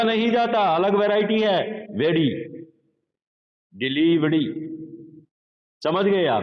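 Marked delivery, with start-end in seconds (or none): 1.9-4.5 वेरी with the r tapped like a quick ड; वेरी, the r flicked off the roof of the mouth; flapped r. Shown as a demonstration. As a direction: say वेरी with a light ड-like flap. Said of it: "ये ड में convert हो जाता है".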